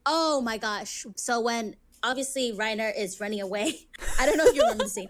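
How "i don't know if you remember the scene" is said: fast, high, through real laughter; can't stop laughing mid-sentence. Real, not acted. Retelling Reiner's escape to her co-host, laughing.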